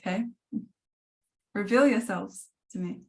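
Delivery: ordinary talk, nothing marked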